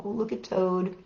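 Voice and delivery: plain reading voice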